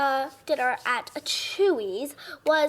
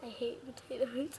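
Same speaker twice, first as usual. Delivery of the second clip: very soft, barely voiced grumble; quiet admission; 14 dB quieter than usual. Real, not acted. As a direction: say it under the breath. Told off, admits dislike.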